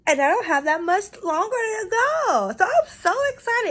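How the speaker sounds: squealing high with excitement; gushing, thrilled about the goal; excited. Real, not acted.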